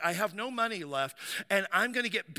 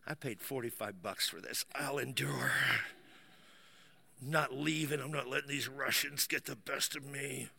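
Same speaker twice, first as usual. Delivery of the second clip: low, strained self-pep-talk; strained determination; 6 semitones lower, 8 dB quieter than usual. Retold or imitated. Sauna sufferer refusing to quit, strained defiant resolve. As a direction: grit it out, building from soft to defiant. Talks himself into enduring the heat.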